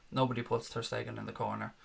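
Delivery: softly spoken movie line, eerie and low-key; creepy hush. Shown as a demonstration. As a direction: breathe it like a creepy secret. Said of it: "that was rather creepy".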